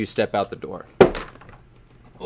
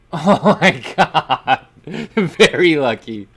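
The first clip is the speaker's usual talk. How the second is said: loud, high, slow laughing exclamation; laughing in disbelief; 5 semitones higher, 8 dB louder, much slower than usual. Real, not acted. Game master marvels at player's lucky armor, laughing hard. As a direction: crack up through the words.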